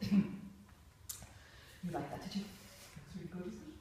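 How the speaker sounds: slow, breathy, sneering drawl; a soft, mocking, defensive sneer; sarcastic, knocked off. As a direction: let it drip with wounded sarcasm. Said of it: "You don't kind of go".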